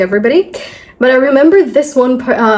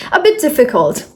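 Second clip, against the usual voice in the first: usual speaking voice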